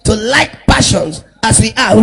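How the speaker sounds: loud shouted reading; shouted scripture. Real, not acted.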